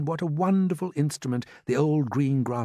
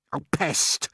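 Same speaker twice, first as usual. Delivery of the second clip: short, irritated exclamation; a touch of peevish earthworm; earthworm's cross cry; 2 semitones higher than usual. A narrator's reading. Earthworm fed up with rude centipede, crying out crossly. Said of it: "cried the earthworm".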